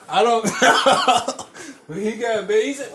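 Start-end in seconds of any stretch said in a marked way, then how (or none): none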